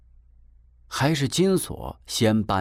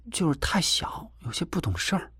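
softer, higher, half-whispered defence; soft excusing; 8 semitones higher, 5 dB quieter than usual. A narrator's reading. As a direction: gently make excuses for the child, voice dropped. 姐姐替孩子小声辩解. (压低音量替孩子轻声开脱)

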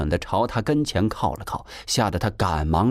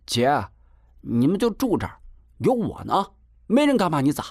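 put-on eager boy vouching for his sister, high-pitched; 金锁打包票; 7 semitones higher than usual. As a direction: reassure her with high, cocky confidence. (男孩音调高扬地拍胸脯保证)

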